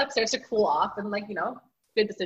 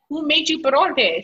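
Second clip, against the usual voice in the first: higher, louder mock-surprised mom; slow, raised-pitch retold astonishment; mom's surprised question; 6 semitones higher, 8 dB louder than usual. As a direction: act out mom demanding to know who cooked. Mom discovers son cooked by himself.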